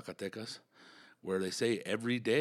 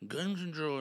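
British guy; 7 semitones higher than usual. Retold or imitated. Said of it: "the first issue that came up was".

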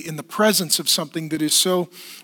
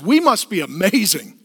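smug cat theology. Retold or imitated. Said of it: "cat theology says".

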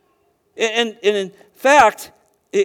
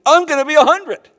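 higher and louder, playfully incredulous; mock exasperated outburst; 8 semitones higher, 7 dB louder than usual. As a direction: blurt it out as if the numbers can't be real. Abraham doing the math aloud.

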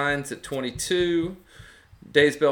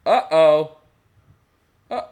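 surprised; 5 semitones higher, 11 dB louder than usual. Real, not acted. Reacting to something on screen.

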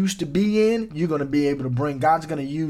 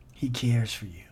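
whisper; 4 semitones lower, 5 dB quieter than usual. Real, not acted. Tender repeated reassurance.

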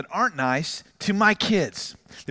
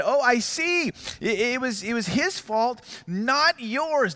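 higher-pitched sarcastic realization; mock understanding; 5 semitones higher, slower than usual. Dad's imagined retort to teens blaming his son, sarcastic. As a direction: play at seeing their point, pitched up.

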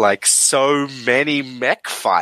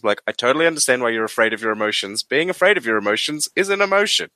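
ordinary talk

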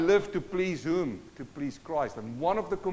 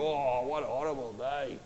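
lower, sulky moaning; mock gloomy complaint; 6 semitones lower, 3 dB quieter than usual. Mocking a gloomy believer moaning about the rain, lower and sulky. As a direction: grumble at the rainy morning.

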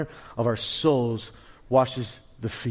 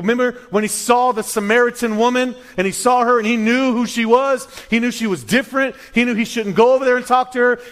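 rising preaching; 8 semitones higher, 5 dB louder than usual. Acted. Preaching rises.

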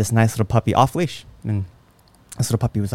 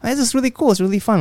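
much higher, happy dog voice; the puppy loving the chase; excited puppy voice; 11 semitones higher, faster than usual. Retold or imitated. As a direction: voice the dog, thrilled. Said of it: "dog's inner voice".

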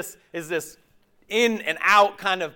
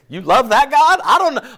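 mock doubtful outburst; 7 semitones higher, 11 dB louder than usual. Mock doubt at God's words.

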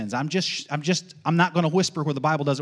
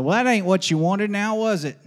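Southern buddy's needling; 5 semitones higher, 4 dB louder than usual. Retold or imitated. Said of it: "a buddy that used to say a lot down south".

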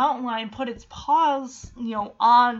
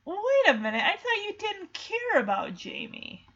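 high, questioning challenge; Shadow; 8 semitones higher than usual. Acted. Dog catching Chance out about Jamie, high mock surprise. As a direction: pitch it up, mildly incredulous. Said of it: "Shadow's like".

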